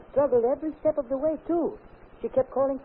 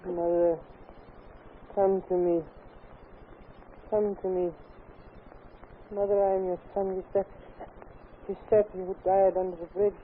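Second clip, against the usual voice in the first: put-on lower, eerie man's voice coming from a girl; voice of a dead man; 6 semitones lower than usual. Dead son speaking through the girl to his mother, eerie man's voice. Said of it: "She talks in the voice of a man, a dead man".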